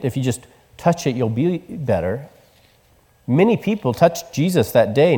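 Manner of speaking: usual speaking voice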